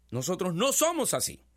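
ordinary talk, nothing marked

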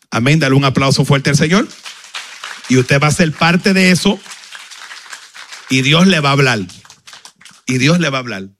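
rally the congregation to applaud; preaching. Acted.

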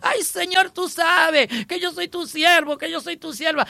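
high, wailing fake lament to the Lord; mock the self-pitying believer begging God over a TV; mock whining prayer. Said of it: "usted comienza a decir".

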